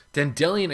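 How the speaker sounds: Dandelion said as dan-DELL-ee-un, high and loud; alternative pronunciation. Shown as a demonstration. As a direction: push the middle of Dandelion, ending in "ee-un". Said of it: "I can at least, like, understand".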